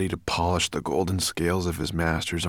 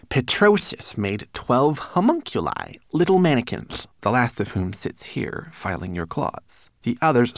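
recited; 7 semitones higher, 4 dB louder than usual. A narrator's reading. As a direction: recite grandly in a small servant voice. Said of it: "Twigleg obediently recited".

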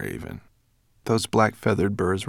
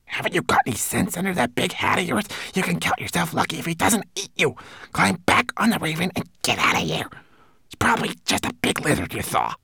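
high, fast, hissing servant; hissed scolding; 11 semitones higher, faster than usual. A narrator's reading. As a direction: scold him in an urgent stage whisper. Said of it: "he hissed".

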